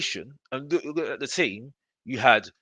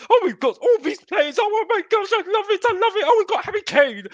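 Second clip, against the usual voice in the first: much higher, louder fangirl squeal; mock swooning fan; 5 dB louder than usual. Mocking fans who love the squad, high squealing delight. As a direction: squeal with delight at each name. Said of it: "looking at and saying".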